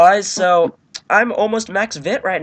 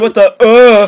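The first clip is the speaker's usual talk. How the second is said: louder, exaggerated repeat; 2 semitones higher, 9 dB louder, slower than usual. Shown as a demonstration. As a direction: say it again, bigger and louder, in a put-on voice. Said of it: "I said".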